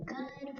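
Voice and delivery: soft, drawn-out GOOD with no stress; unstressed first syllable. Shown as a demonstration. Teacher showing the weak half of goodbye, GOOD unstressed. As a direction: leave GOOD soft and unaccented. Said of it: "we're not stressing anything".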